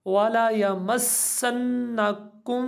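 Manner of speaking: मस्सन्ना with the double न held and nasalised; with ghunna. Shown as a demonstration. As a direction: hum the nasal through मस्सन्ना. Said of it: "हम वहाँ पे गुन्ना करते हैं".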